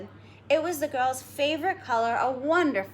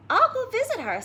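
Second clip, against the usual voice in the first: put-on little girl; 11 semitones higher, 4 dB louder than usual. Girl voiced in story.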